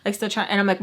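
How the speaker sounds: usual speaking voice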